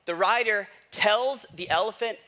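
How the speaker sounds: ordinary delivery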